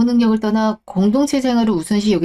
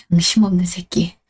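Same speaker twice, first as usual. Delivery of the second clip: low contemptuous insult; 6 semitones lower than usual. Acted. Insulting ex-husband in quarrel.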